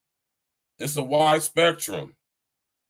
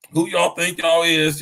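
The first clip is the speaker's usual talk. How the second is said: mock preacher call; 7 semitones higher, 6 dB louder than usual. Calling Juju down from clouds.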